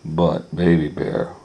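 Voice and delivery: ordinary delivery, nothing marked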